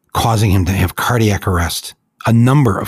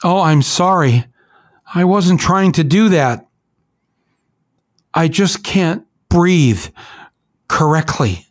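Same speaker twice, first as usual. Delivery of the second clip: tearful plea; 8 semitones higher, slower than usual. Retold or imitated. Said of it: "He's crying".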